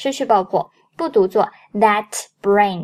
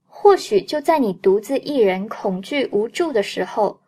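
ordinary delivery

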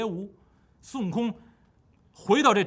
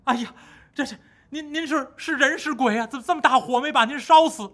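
higher, stammering with fright; frightened monks; 7 semitones higher, 4 dB louder than usual. Acted. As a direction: gasp at what looks like a ghost. Monks startled seeing survivors. (惊慌结巴的和尚)

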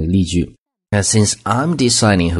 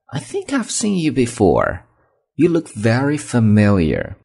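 curious friendly tone; 5 semitones higher than usual. Shown as a demonstration. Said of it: "这种语气很重要".

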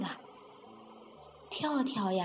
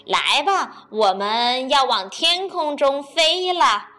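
louder, encouraging stork voice; sly stork; 2 semitones higher, 10 dB louder than usual. Acted. Stork praises the frog before carrying him up, false cheer.

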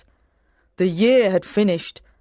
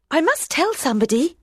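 eager; 9 semitones higher, faster than usual. Acted. Wife bursting to tell secret.

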